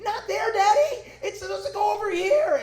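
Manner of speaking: very high, loud mock wail; bawling child. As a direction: imitate a small kid's tantrum, stretching words out. Imitates his kids protesting at dinner.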